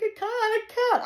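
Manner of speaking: high, loud desperate cry of I can't; panicked protest. Retold or imitated. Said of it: "I'm like".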